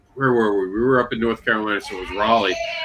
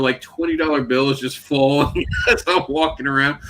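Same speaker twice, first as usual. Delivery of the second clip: ordinary talk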